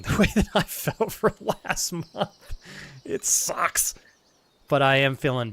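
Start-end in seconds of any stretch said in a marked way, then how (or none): 0.0-3.9 high, slow, laughing through tears; close to crying. Real, not acted. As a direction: push it out as emotion takes over. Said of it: "if I start crying".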